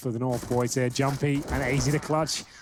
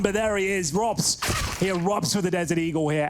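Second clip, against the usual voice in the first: higher, rising hype call; excited; 5 semitones higher than usual. Real, not acted. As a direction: build up to the name. Caster hypes a big play.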